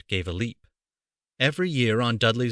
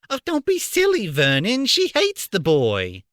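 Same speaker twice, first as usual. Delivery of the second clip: scornful aunt; 10 semitones higher, 3 dB louder than usual. A narrator's reading. Petunia dismissing Vernon's idea.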